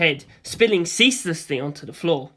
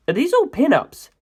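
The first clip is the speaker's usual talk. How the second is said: surprised; 9 semitones higher, 4 dB louder than usual. A narrator's reading. Narrator surprised at pictures.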